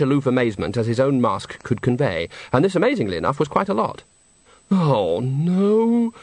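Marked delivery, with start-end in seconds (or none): 4.7-6.1 moaning. A narrator's reading. Bucket moaning as the opera turns into pantomime. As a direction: groan it out in dismay. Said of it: "moaned Bucket".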